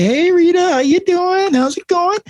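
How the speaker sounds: very high sing-song hello; mock cheery greeting. Staff greeting a regular ER patient, mock cheery. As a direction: put on a high-pitched, over-friendly greeting.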